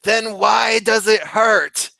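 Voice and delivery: high, slow, yelled complaint; patient complaining to the nurse; whining patient. Retold or imitated. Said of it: "he says".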